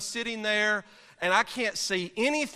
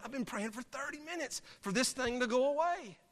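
hushed, held-back complaint, softer; speaking very quietly; 5 semitones higher, 7 dB quieter than usual. Retold or imitated. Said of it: "I'm speaking very quietly".

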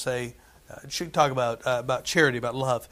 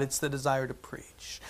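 whispered; 2 semitones lower, 10 dB quieter than usual. Acted. Preacher to congregation about the call, whispered. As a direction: drop to a sudden whisper. Man called of God, emphatic point.

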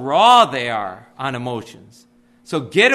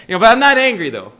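mock denial; 3 semitones higher, 7 dB louder, much faster than usual. Pastor mocking people who deny anger, huffy mock denial. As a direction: insist you're fine while clearly not. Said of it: "We got a whole list of these".

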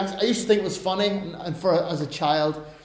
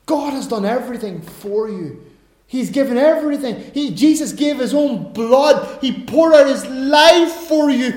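rising to louder, higher pleading; preacher pressing the congregation toward belief; building preaching; 5 semitones higher, 7 dB louder than usual. Acted. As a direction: build from calm to fervent appeal.

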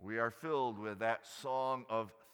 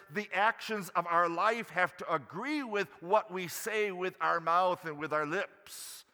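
ordinary talk, nothing marked

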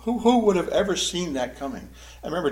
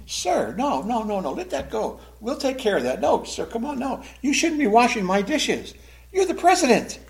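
high, rising protest that peaks on "president"; flustered protest; 7 semitones higher than usual. Pretend. Dinner host protesting to the washing-up president, flustered. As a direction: stop him in embarrassed surprise.

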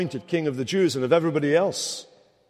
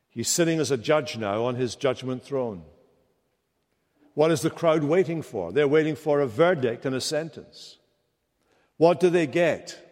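ordinary talk, nothing marked

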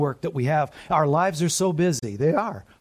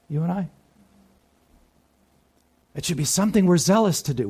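usual speaking voice

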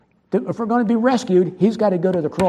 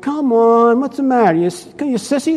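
taunting; 5 semitones higher than usual. Retold or imitated. Friends taunting preacher who refused drink.

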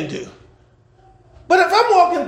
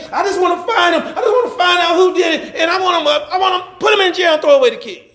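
higher, insistent retold plea; grieving mother's demand; 6 semitones higher than usual. Pastor voicing mothers whose kids got killed, insistent. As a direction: imitate a mother demanding justice.

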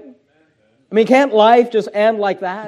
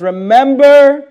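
"Remember" called out high, loud and slow; stress on remember; 5 semitones higher, 7 dB louder, much slower than usual. Shown as a demonstration. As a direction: ring out "Remember" like a summons. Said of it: "notice this phrase".

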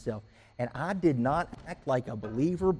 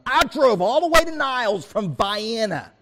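exasperated rant; 10 semitones higher, 8 dB louder than usual. Retold or imitated. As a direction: vent the whole wasted trip in a yelling rant. Ranting about the wasted trip.

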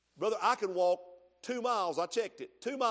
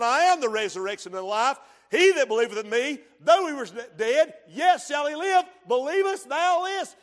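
loud, high, faster proclamation ending in a shout; Jesus proclaiming; 7 semitones higher, 9 dB louder than usual. Acted. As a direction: proclaim it to the whole church. Jesus voiced, preached loud.

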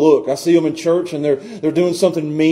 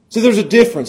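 ordinary talk, nothing marked

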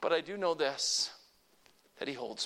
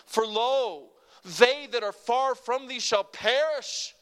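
stern proclamation; 4 semitones higher, 6 dB louder, slower than usual. Acted. Reading judgment verses.

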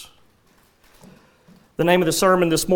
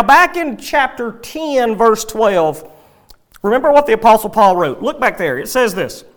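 ordinary delivery

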